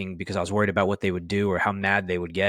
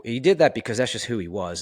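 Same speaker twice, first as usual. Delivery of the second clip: ordinary delivery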